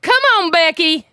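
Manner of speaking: high, loud coaxing in mock accent; put-on Becky accent. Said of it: "laughing too much at your accent".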